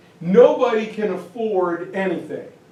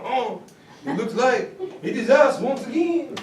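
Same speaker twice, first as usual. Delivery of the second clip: put-on jokey French accent, higher and laughing; 5 semitones higher than usual. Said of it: "that's about my best French accent".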